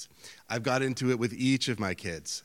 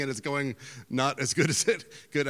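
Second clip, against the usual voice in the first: usual speaking voice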